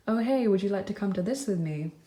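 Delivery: ordinary delivery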